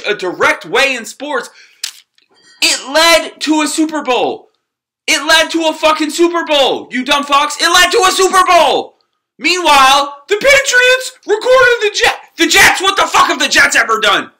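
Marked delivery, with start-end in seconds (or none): 2.6-9.0 higher and louder, yelling at the haters; angry shout. Real, not acted. 9.4-14.3 much higher and louder, outraged; furious yell. Real, not acted. Continued shouted rant.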